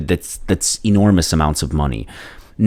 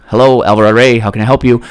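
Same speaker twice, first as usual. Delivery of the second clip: louder, higher receptionist greeting; imitated secretary; 6 semitones higher, 7 dB louder, faster than usual. Secretary answers the second ring, imitated phone greeting. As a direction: put on a receptionist answering the intercom. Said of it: "the secretary answers on the intercom".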